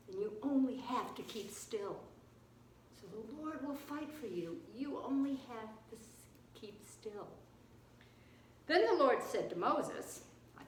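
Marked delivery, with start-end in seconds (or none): 3.0-7.3 soft, fading almost to nothing; hushed repeat. Shown as a demonstration. Preacher repeats Moses' promise to keep still, hushed. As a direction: say it gently, sinking into stillness.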